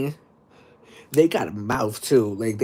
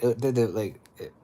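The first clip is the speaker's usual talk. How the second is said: ordinary talk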